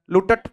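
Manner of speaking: लूटट said with a doubled final t; wrong double t. Shown as a demonstration. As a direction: stretch out लूटट with two t sounds at the end. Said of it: "बोल के देखो जरा".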